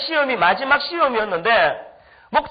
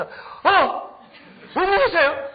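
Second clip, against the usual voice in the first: imitating a startled boy, pitched higher; surprised boy; 8 semitones higher, much slower than usual. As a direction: act out the boy's stunned surprise. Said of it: "두 손 이렇게 벌리면서". (놀란 아이를 흉내 낸 높은 목소리)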